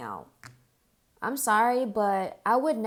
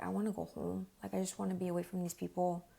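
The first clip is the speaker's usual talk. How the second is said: softer, hushed and hurried; quiet; 10 dB quieter, faster than usual. Retold or imitated. Wanting to get away from these people, a hushed quick murmur. Wanting to leave, playing along.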